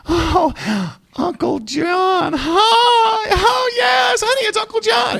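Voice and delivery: shrill, overdone sugary welcome cried out high and loud; fake sweet greeting. Preacher mocking a fake family greeting, high gushing cry. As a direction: gush at the relative you hate, way up high. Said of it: "you smile at them and say".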